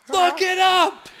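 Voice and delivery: squeaky high laugh through the words, slow; words lost in real laughter. Real, not acted. Laughing at himself while insisting to Joe. Laughing at his own argument.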